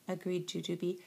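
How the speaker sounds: plain reading voice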